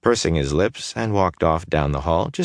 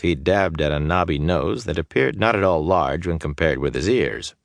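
plain reading voice

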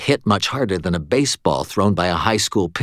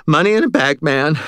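out of breath, gasping; panting; 7 semitones higher, 4 dB louder than usual. A narrator's reading. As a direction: pant the words. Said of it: "Stan panted".

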